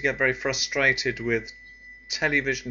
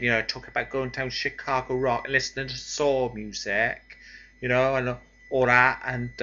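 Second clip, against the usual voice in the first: sloppy, broad Bristol women's talk; broad Bristol accent. Shown as a demonstration. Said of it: "example of a broad accent".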